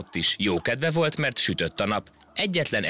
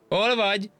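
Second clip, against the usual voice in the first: calling out. Acted. Monkey calls to the ant from the far tree. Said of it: "a majom már kiabált".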